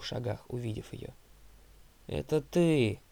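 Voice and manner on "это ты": loud, hostile recognition; with dislike. A narrator's reading. Zoe recognises Thalia, speaking with dislike. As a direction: throw it out with distaste. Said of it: "сказала она с неприязнью".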